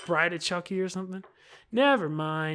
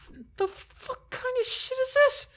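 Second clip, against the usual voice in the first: much higher incredulous outburst; outraged surprise. Real, not acted. Gamer reacting in disbelief to a character's flowery line.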